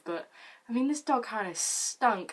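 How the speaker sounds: normal talking voice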